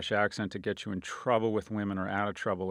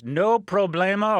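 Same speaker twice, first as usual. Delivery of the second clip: mock Spanish; 9 semitones higher, 8 dB louder, slower than usual. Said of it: "or as they say".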